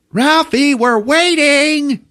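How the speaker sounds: high, slow sing-song call; mother calling her son down; mom calling. Retold or imitated. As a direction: call upstairs like a doting mother. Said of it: "mom calls Ralphie downstairs".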